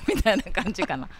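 ordinary delivery

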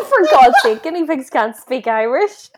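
loud high laughing protest. Real, not acted. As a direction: protest while laughing hard. Laughing exasperation.

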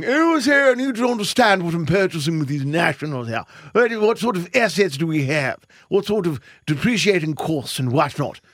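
lightly voiced smug billionaire owner; a hint of a wealthy tycoon; rich guy voice. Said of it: "That's my rich guy voice".

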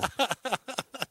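high-pitched burst of laughter. Real, not acted. Big laugh after joke.

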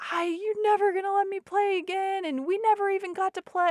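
high-pitched imitation of a child's yell; mock yelling. Son yelling at mom when game time ends, mock yelling. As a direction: imitate an upset kid's high, protesting wail. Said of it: "He was yelling at me".

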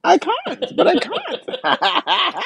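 high, laughing mimic of a friend; Bobby's voice. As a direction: squeak it out through laughter. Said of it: "I love when you mimic Bobby".

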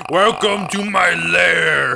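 booming echoing villain monologue; slow, high, booming villain welcome. Pretend. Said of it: "can you guys do a spooky laugh".

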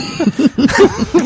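high-pitched burst of laughter; laughing. Real, not acted. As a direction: crack up, high and helpless. Cracking up at the name.

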